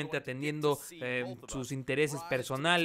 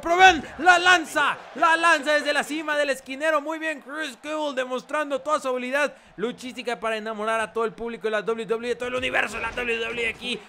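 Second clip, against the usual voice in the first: high-energy match caller; play-by-play commentary; 7 semitones higher, 5 dB louder than usual. Acted. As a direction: hype the big move. Live wrestling play-by-play.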